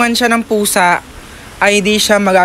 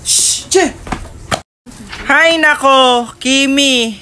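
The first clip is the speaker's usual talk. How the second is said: loud scolding shout; shooing cat; 7 semitones higher, 4 dB louder than usual. Real, not acted. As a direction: chase the cat off, yelling.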